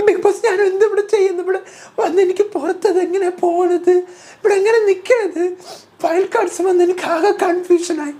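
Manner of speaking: very high, laughing mock wail; hammed-up whiny Jasmine. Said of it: "എന്ന് പറഞ്ഞുകൊണ്ട് Jasmine".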